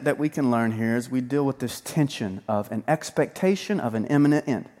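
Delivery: usual speaking voice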